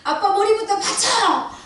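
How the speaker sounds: high, loud frantic shout for help; screaming in sheer panic; panicked yell to son. Retold or imitated. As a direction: yell at your son to hold his father's head. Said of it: "소리 지르면서 태영이를 불러서".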